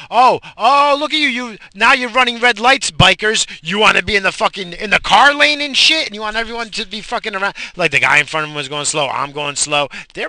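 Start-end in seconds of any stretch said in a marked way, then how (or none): 0.1-7.5 much higher and louder, furious yelling at bikers; angry yelling. Real, not acted. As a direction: blast them out of road rage.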